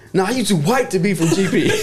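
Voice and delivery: high, laughing imitation of her; mock dismissive woman. Woman waving off the buddy, high mocking scoff. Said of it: "looks at him and goes".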